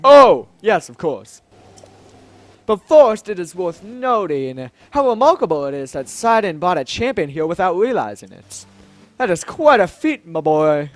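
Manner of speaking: hearty, declaiming monarch with swelling emphasis; grand Zora king, jovial. Acted. Zora king praising the champion's arrival, grand and jovial. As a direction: play a stately, pleased king.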